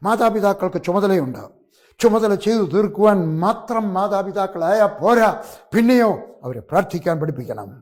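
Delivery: a preacher shouting his point to the church; fiery preaching. Acted. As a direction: thunder it at the congregation like a revival preacher. Preaching at full force.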